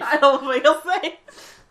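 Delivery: high, loud, slow whine; mock distress. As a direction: stretch it out in mock panic. Repeats it dramatically.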